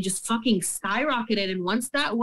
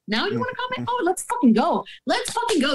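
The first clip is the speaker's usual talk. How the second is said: worked up, daring the company to fight; fired-up mock challenge; 12 semitones higher than usual. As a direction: rise up to an incredulous, challenging shout. Angry retort to company.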